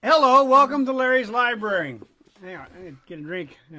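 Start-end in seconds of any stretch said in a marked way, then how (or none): 0.0-2.0 loud, high showman welcome; louder, higher retake of the welcome; big host greeting. Shown as a demonstration. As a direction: boom it out like a radio host. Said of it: "redoes the show greeting louder".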